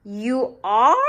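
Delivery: put-on child startled by the teacher's remark; surprised question. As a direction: caught off guard by the teacher. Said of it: "said Victoria".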